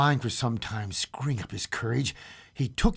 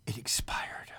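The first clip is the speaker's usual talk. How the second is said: whisper; 8 dB quieter than usual. A narrator's reading. Whispered ending of sentence.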